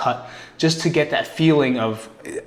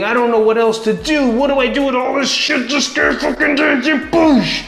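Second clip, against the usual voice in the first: higher, building frustrated outburst; frustration boiling into anger; 10 semitones higher, 3 dB louder than usual. Retold or imitated. Voicing his own overwhelmed thoughts turning angry: higher, rising outburst. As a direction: let overwhelm snap into rage, pitch climbing. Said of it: "that's what anger becomes".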